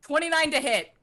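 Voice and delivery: high, excited call-out of a roll; excited yelling. Real, not acted. Player calling her attack roll to game master, excited yelling. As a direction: announce it with rising excitement. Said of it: "Sorry, I'm yelling".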